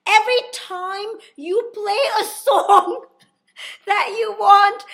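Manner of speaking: high, loud, laughing through the words; cracking up while quoting him; laughing quote. Retold or imitated. Telling friends about the tour guide, laughing hard. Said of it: "and Luis goes".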